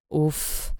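soft, low relieved exhale; relieved sigh. A narrator's reading. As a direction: breathe out the relief. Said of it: "z ulgą w głosie".

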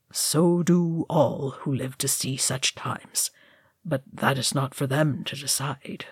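gentle and soft. A narrator's reading. Gandalf gently consoling Frodo, soft and hushed. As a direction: soften into a near whisper. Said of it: "Gandalf said gently".

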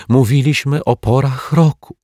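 put-on a touch of sniffling child. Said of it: "chlipała Asia".

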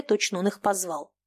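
ordinary delivery

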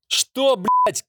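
shrieking high shout of fury. A narrator's reading. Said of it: "крик демона".

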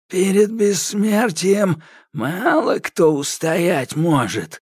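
high, slow gloating sorcerer; evil smirk. A narrator's reading. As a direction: gloat with a wicked smile. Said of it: "зло улыбнулся Кощей". (Кощей злорадно улыбается)

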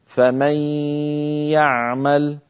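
فمن يعمل بغنة طويلة كاملة; مدّ الغنة في يعمل; إدغام بغنة. Shown as a demonstration. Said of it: "ومططناه حتى صار طويلا غنة كاملة". (غنة كاملة ممطوطة)

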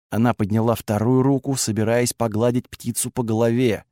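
ordinary delivery, nothing marked

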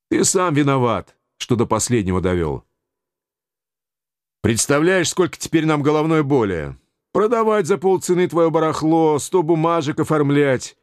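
scolding official. A narrator's reading. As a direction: blame him while complaining.

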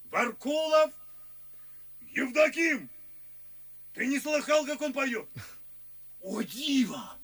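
loud, high proud introduction; loud, high boasting about a singer; rapturous announcing. Acted. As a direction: trumpet the name like a marvel. Proudly presenting a friend.

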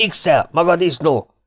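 high-pitched officer's roar; angry yell. A narrator's reading. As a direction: yell an insult in fury. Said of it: "ordította".

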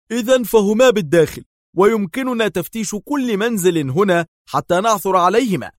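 high, loud, worked-up outburst; agitated. A narrator's reading. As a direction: burst out excitedly, pitched well up. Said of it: "قال دار في انفعال".

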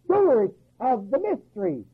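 ordinary talk

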